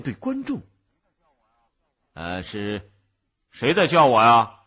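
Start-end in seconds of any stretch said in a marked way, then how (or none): 2.1-4.6 洪亮地慢慢发问; 茫然一顿，再大声问话; 银幕人物大唐. Acted. 银幕上的大唐向观众发问. (迟疑后放大嗓门询问)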